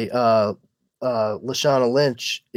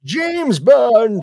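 hammed-up, higher-pitched Bond introduction; old James Bond; 9 semitones higher than usual. Aged Bond introducing himself at the end of his run, hammed up. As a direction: put on an aged spy announcing his name.

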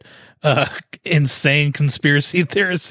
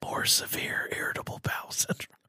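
right in your ear; whispering; 15 dB quieter than usual. Acted. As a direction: whisper close to the mic. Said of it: "and you whisper".

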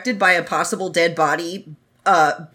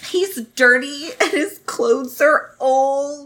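slow whiny complaint; 4 semitones higher, slower than usual. Retold or imitated. Voicing jealous Ah Ching.

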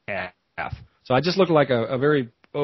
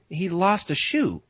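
normal talking voice

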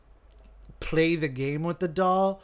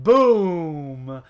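big, drawn-out explosion word; loud boom; 10 dB louder than usual. Retold or imitated. Host telling how the mirror bursts, loud dramatic boom. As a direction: boom it out much louder.

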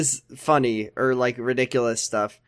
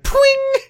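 high squeaky pwing chime; mock chime; 4 dB louder than usual. Mocking the show's chat sound, high ping. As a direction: imitate a video-chat ping, high and sharp. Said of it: "It's going like".

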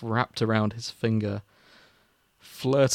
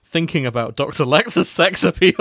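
usual speaking voice

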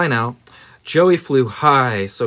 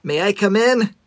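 put-on polite boy voice; 8 semitones higher than usual. Joey asks politely, hopeful.

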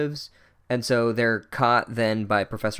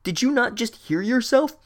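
incredulous; 9 semitones higher than usual. Real, not acted. Incredulous rant at character.

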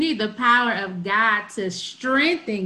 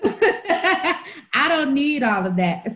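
higher, drawn-out, laughing brush-off; laughing mock refusal; 5 semitones higher, 3 dB louder, much slower than usual. Teacher force-fielding herself from students' baggage, laughing mock refusal. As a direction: playfully wave it away through a laugh. Jokey quote of shielding oneself, laughing.

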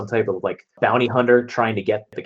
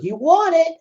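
put-on taunting kid. Kid taunting while throwing it.

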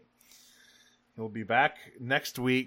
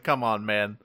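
ordinary delivery

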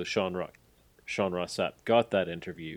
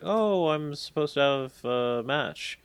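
lightly voiced hesitant wrestler, higher and slow; a slow, higher, puzzled voice, as if lost backstage; unsure newcomer; 7 semitones higher, 5 dB louder, slower than usual. Newcomer explaining herself to Allie, a light hint of unsure.

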